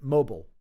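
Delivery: Mobile with a reduced ending, "moh-bul". Shown as a demonstration. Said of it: "am I saying that right?".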